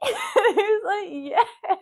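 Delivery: high, loud, through real laughter; helpless giggly retelling; laughing. Real, not acted. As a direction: crack up while telling it. Laughing as she tells the exchange.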